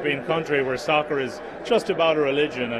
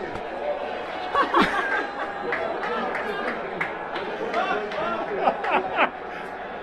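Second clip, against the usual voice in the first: excited laugh; 4 dB quieter than usual. Real, not acted. Guest laughs at cheering question.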